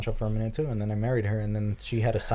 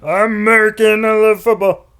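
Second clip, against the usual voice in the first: mock American fan; 11 semitones higher, 11 dB louder than usual.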